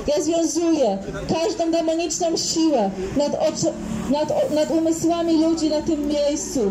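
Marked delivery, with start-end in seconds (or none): none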